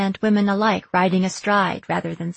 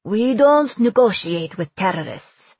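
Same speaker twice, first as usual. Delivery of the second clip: put-on slow, louder Persian accent; 5 dB louder than usual. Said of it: "emphasizing the Persian accent".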